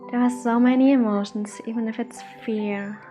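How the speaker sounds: usual speaking voice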